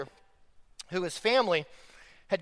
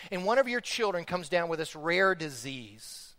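normal talking voice

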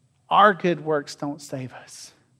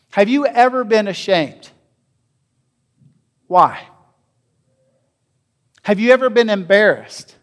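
normal talking voice

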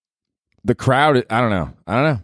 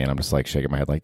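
normal talking voice

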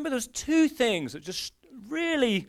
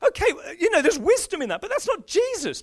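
amused dismissal; 7 semitones higher than usual. Retold or imitated. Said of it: "You're like".

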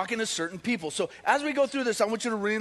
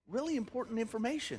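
softer, hushed repeat of the phrase; quiet emphasis; 2 semitones higher, 8 dB quieter than usual. Shown as a demonstration. Pastor quietly repeats key phrase to congregation. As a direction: drop the voice to let it land.

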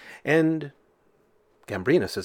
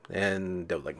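ordinary talk

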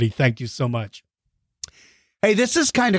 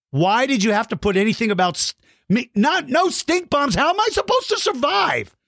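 mock panic; 6 semitones higher than usual. Mock panic about stink bombs.

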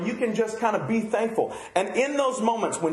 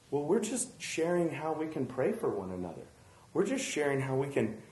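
softer, sing-song mock of an innocent excuse; 3 semitones lower, 7 dB quieter than usual. Pastor mimicking wives excusing gossip in prayer groups, soft mock innocence. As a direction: play it down like it's harmless sharing.

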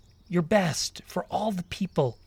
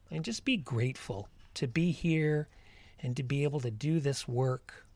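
guided meditation; 4 dB quieter than usual. Acted. Leads a walking meditation.

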